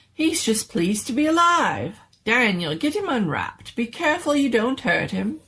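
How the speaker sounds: high, loud, thrilled scientist voice; excited mad doctor. Acted. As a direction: crow with triumph, pitch climbing.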